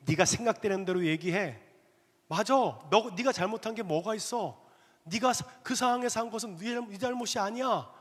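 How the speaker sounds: the devil urging the angry husband to fight; devil's voice. Acted. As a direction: egg him on like a sly devil. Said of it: "라는 마귀의 소리가 들리기 시작했습니다".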